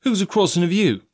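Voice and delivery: high, protesting sailor voice; cried out. A narrator's reading. Coxswain protesting to the ringleader, crying out. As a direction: pitch it up in protest. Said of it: "cried Israel".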